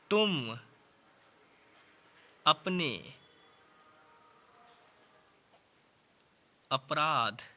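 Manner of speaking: slow word by word dictation; dictating to a class. Shown as a demonstration. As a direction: read the sentence out one word at a time, slowly. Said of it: "दूसरा मैं क्या लेता हूँ".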